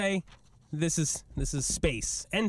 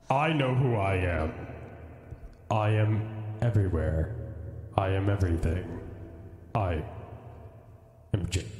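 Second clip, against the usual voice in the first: deep oracle fish voice; 7 semitones lower than usual. Acted. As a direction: speak like an all-knowing deity fish. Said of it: "such a deep voice".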